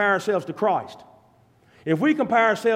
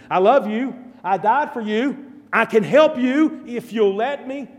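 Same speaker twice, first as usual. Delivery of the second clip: louder, rising invitation; ardent plea; 5 semitones higher, 5 dB louder than usual. Acted. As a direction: reach out to the listener. Christ voiced inviting the hearer.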